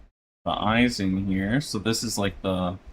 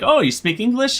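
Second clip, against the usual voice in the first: high mock-surprised Family Guy question; Peter Griffin; 12 semitones higher than usual. Peter asking the Mexican guy, lightly voiced, high mock surprise. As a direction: lightly pitch it up like a cartoon dad. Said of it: "Peter turned to him and was like".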